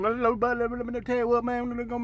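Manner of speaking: garbled, rushed mumble run together. Retold or imitated. Mimicking his unintelligible chatter, garbled mumbling. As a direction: unintelligible slurred rapper talk.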